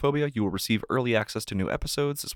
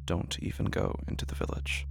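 whispered command; Jesus quietly warns the healed man, whispered; 2 semitones lower, 7 dB quieter than usual. Acted. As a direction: breathe the line out as a whisper. Jesus's words in scripture reading.

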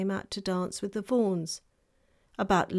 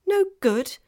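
high, loud alarmed question; startled girl, suddenly afraid; frightened; 10 semitones higher, 6 dB louder than usual. A narrator's reading. Lucy alarmed by the faun, high frightened cry. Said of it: "feeling rather frightened".

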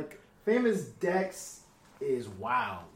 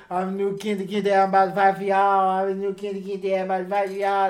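slurred words, higher and louder; slurred rapper impression; 5 semitones higher, 9 dB louder than usual. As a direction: slur it like the rapper does. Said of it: "that's literally how he sounds".

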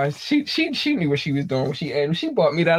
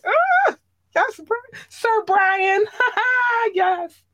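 high squealing excitement with laughs; giddy squeal; much slower than usual. Real, not acted. Host squealing with joy over Sir Brian. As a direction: squeal and laugh, can't contain it. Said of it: "y'all just made me excited".

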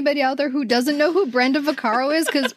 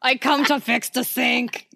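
plumber impression. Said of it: "See, we can't stop".

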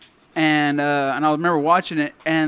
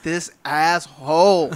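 slow inner grumble; much slower than usual. Retold or imitated. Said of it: "in my head, I was like".

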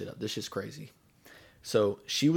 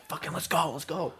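raised-pitch retold urging; urgent order; 6 semitones higher than usual. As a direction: hurry her along, voice pitched up.

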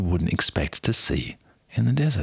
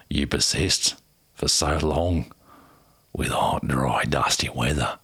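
put-on a dramatic Aussie accent, lower and slow; 3 semitones lower, slower than usual. Quoting the ranger on the drought, dramatic Aussie accent. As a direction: play the weathered outback ranger. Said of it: "dramatic Aussie accent for you".

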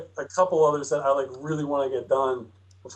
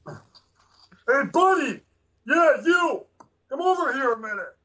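calling guard voice; 10 semitones higher, slower than usual. Acted. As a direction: call him over like a rough cop.

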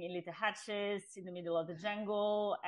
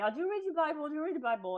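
friend's eager question; 7 semitones higher, much faster than usual. Retold or imitated.

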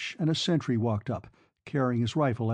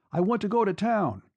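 urgent request; 7 semitones higher than usual. A narrator's reading. Breathless fare begs the driver.

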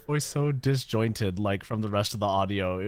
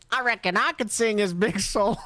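Stinky voice; 10 semitones higher than usual. Retold or imitated. Voicing Stinky.

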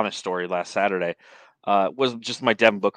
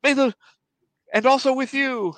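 high, raised congregant voice; hammed-up churchy reply; mock church response; 5 dB louder than usual. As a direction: imitate calling out a liturgical response, pitched way up.

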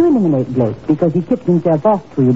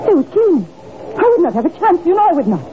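frightened plea; 10 semitones higher, faster than usual. Acted. Villain fears the mob.

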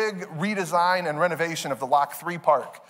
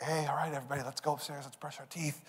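much softer, low-key dad voice; gentle wind-down; 4 semitones lower, 13 dB quieter, faster than usual. Retold or imitated. Dad sending kids up to bed, soft and low-key. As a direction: ease them quietly toward bed.

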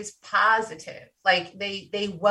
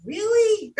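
mock disbelief; 13 semitones higher than usual. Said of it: "And I'm like".

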